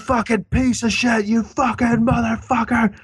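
high, strained mock rage at someone; screaming abuse in a high voice; mock angry yell. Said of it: "he's like".

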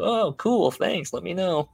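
high sing-song fake gratitude; mock cheerful manager. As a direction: imitate a manager staying brightly agreeable. Manager feigning cheer at feedback.

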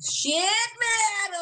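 high, drawn-out cry of admiration; awed exclamation. Real, not acted. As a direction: exclaim it high, blown away. Raving about falsetto singing.

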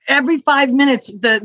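usual speaking voice

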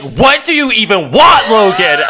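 high, slow, drawn-out imitation of exasperation; hammed-up fed-up yell into the phone; mock exasperated shout. Xavier picks up Logan's payphone call, in a mock exasperated shout.

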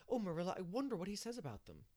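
excited, high and wondering; excitedly. A narrator's reading. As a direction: burst out with eager curiosity. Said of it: "she said excitedly".

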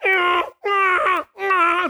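hee-haw like a panicked donkey; donkey bray. Retold or imitated. The donkey zigzagging downhill, braying. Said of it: "She's like".

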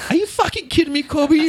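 very high, incredulous comic protest; mock outrage. Bodybuilders before a show scold Kobe, high-pitched mock outrage. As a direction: squeal it like an exasperated lifter.

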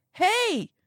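high, loud call; a sudden hailing shout. A narrator's reading. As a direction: call out across the office. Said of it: "shouts a voice".

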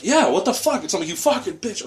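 high-pitched, outraged ranting; retold angry yelling. Rider venting to bystander about the woman, retold outraged yelling. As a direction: act out losing it, voice pitched right up. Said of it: "I'm just yelling".

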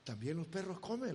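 soft, low and quick, savoring the line; hushed repeat. Acted. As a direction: murmur the answer to yourself. El predicador repite la respuesta. (en voz baja y rápida)

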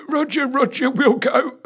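very high, mocking chortled jibe; chortling. A narrator's reading. Old corporal mocking the sergeant's name, chortling. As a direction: tease in a squeaky, chortling voice. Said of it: "chortled Longstocking under his breath".

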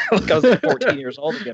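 high laughing cracked voice. Real, not acted. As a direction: laugh through a squeaky break. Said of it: "my voice cracked like I was going".

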